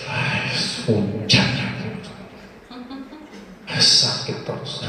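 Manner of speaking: whispered, slow moaning complaint; soft whiny grumble; mock complaining. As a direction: sigh it out like a grumbling patient. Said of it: "Itu ngeluh itu namanya".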